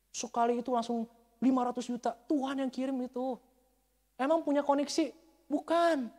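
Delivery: high, loud, mounting insistence; emphatic preaching shout. Real, not acted. Preacher testifying that God sent the money, emphatic shout.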